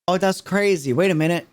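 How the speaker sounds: ordinary talk, nothing marked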